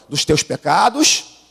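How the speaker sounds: ordinary delivery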